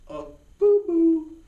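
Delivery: put-on high, slow little-kid voice; cutesy mock baby voice; baby talk. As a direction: say it like scolding a toddler sweetly. Teacher mocking a childish mistake.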